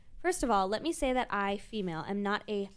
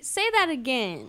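incredulous demand; 4 semitones higher, 7 dB louder, slower than usual. Real, not acted. Mock-demanding repeat, shocked.

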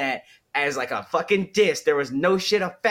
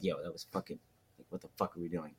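much softer and lower, kept private; hushed aside; 8 semitones lower, 14 dB quieter, faster than usual. Retold or imitated. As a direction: say it low so only mom hears. Telling mom later, privately.

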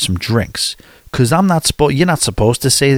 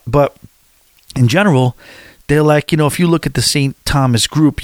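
ordinary talk

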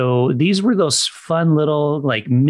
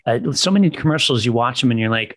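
usual speaking voice

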